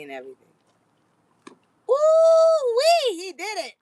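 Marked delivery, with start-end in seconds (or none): 1.9-3.7 very high, loud cry of delight; excited ooh-wee. Real, not acted. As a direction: whoop for the dog's catch. Cheering dog's catch.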